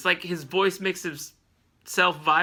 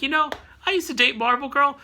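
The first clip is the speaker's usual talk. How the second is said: lightly voiced crazy old man, pitched up; hint of an old-timer reminiscing, higher voice; 6 semitones higher than usual. Old Ted bragging about his past to youngsters, lightly voiced crazy old man. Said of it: "crazy old man who is like".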